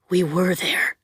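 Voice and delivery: plain reading voice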